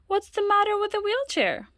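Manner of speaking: high, puzzled asking; puzzled question. Acted. Parents puzzled why Loretta refuses, high and baffled. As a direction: ask in bafflement.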